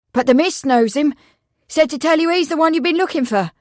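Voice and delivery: put-on high, loud, rushed boy's message; excited. A narrator's reading. The boy passes word to the constable, excitedly. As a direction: hurry the message out eagerly.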